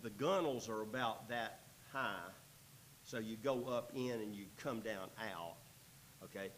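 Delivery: usual speaking voice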